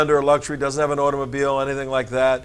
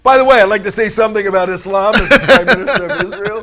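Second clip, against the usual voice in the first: 1 mock prime minister speech; 5 semitones higher, 5 dB louder, faster than usual. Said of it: "can you go off book and say".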